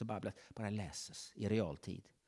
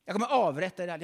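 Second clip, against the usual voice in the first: louder, higher death threat; threatening king; 7 semitones higher, 10 dB louder, much faster than usual. Acted. King threatens to execute his wise men, loud. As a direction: spit out the threat.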